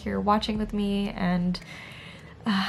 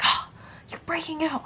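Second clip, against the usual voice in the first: higher, hushed dismay at the mirror; whispered self-criticism; 8 semitones higher than usual. Retold or imitated. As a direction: mutter disappointed at your reflection. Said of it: "this is how I sound in my own head".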